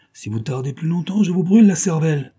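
rising to a loud, high threat; threatening shout. Acted. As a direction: threaten him with the revolver. Threat at gunpoint.

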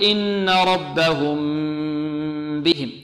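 slow chant, long nasal hum on the M of "ربهم" before "بهم"; lengthened ghunnah. Shown as a demonstration. Teacher modelling concealment, prolonged nasal M in "ربهم". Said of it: "نطيل الغنة فيها".

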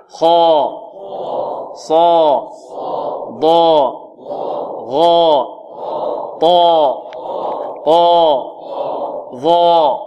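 each of "خا، صا، ضا، غا، طا، قا، ظا" full and heavy, separately; heavy letter drill. Shown as a demonstration. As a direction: sound out "خا، صا، ضا" one by one with emphatic fullness. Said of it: "انطقوا معي". (نطق حروف الاستعلاء «خا، صا، ضا» مفخمة منفصلة)

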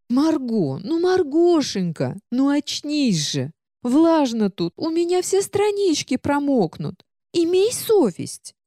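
высоко, протяжно и капризно; ноет, растягивая слова; книга-ворчунья, высокий голос. Acted. Книга будит героиню. (капризная говорящая книга)

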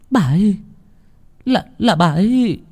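high-pitched, stammering alarm; panicked daughter. Acted. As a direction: stumble over urgent news for your father. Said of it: "Nguyệt hốt hoảng". (giọng con gái hốt hoảng, lắp bắp)

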